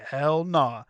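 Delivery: a loud, blunt no; sharp refusal. Retold or imitated. Telling Jarrett off, a loud flat refusal. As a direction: shut the accusation down hard.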